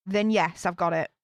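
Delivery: usual speaking voice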